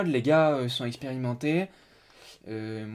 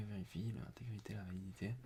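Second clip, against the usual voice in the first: very soft, half-whispered reading; reading to oneself; 5 semitones lower, 19 dB quieter than usual. Real, not acted. Streamer skimming the project page to himself, murmured low. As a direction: murmur it under your breath while skimming. Lit l'écran pour lui-même.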